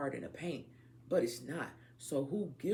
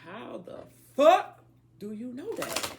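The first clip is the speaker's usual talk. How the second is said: incredulous outburst; 8 semitones higher, 11 dB louder than usual. Real, not acted. Ranting about clone claims.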